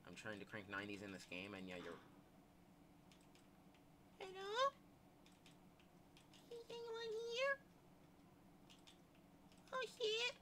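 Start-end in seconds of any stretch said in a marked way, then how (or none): 4.2-10.3 soft, very high squeaky voice; tiny high call. Pretend. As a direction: call out timidly in falsetto. Calls out into the empty game.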